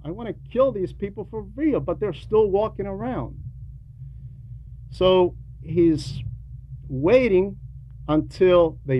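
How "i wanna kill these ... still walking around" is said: higher, quick scheming devil; devil's voice. Retold or imitated. Devil scheming to kill Adam and Eve, high and quick. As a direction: rattle it off like the devil plotting murder. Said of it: "He says".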